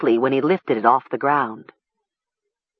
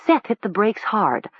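plain reading voice

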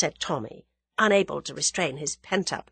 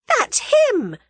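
very high, loud cry of recognition; excited squeal; 8 dB louder than usual. A narrator's reading. Young woman recognising the described man, excited squeal. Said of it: "cried Tuppence in an ungrammatical squeal".

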